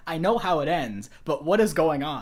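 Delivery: loud bewildered what-is-happening; confused exclamation. Retold or imitated. Reacting to confusing movie scene.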